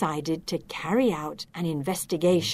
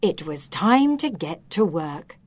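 plain reading voice

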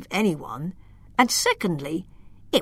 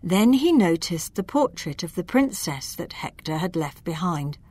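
plain reading voice

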